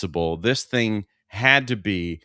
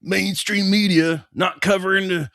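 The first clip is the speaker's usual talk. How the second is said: high, loud conspiracy nut rant; ranting conspiracy host, pitched up; Alex Jones; 9 semitones higher, 4 dB louder than usual. Acted. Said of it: "Is that your Alex Jones?".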